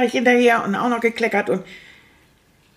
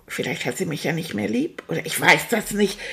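ordinary talk

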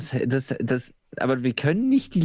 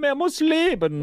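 mock solemn; 12 semitones higher, slower than usual. Mock grand pronouncement.

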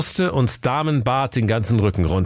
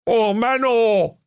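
whiny brothers; 13 semitones higher, much slower than usual. Acted. Brothers whining at the king.

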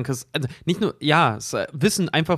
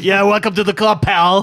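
put-on higher, louder jaded old man; 5 semitones higher, 6 dB louder than usual. Rick's reply to robot quoted.

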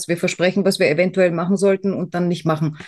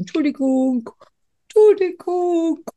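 high, stretched-out teasing call; drawn-out mock apology; 10 semitones higher, slower than usual. Podcaster teasing co-host over a group message, high drawn-out mock apology. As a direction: tease with a high apology, slower and higher the second time.

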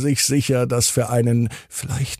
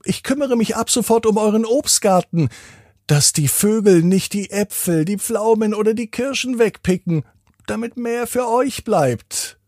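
higher, strange scarecrow voice; 10 semitones higher than usual. A narrator's reading. Scarecrow greets Dennis in the garden, higher storybook voice. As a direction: introduce yourself as a friendly scarecrow. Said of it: "fragt die Vogelscheuche".

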